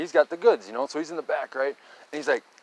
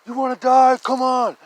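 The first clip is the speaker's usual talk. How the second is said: higher, louder, slow yell to hurry them; urgent high call to come on now; urgent shout; 14 semitones higher, 10 dB louder than usual. Retold or imitated. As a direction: shout at the drunk kids to run for it. Urging friends away from Bigfoot.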